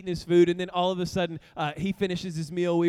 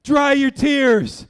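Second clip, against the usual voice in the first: DRY said louder and higher; loud and firm; 6 semitones higher, 11 dB louder, slower than usual. Retold or imitated. Father ordering his crying son loudly to stop. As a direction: insist loudly. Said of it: "And I'm saying".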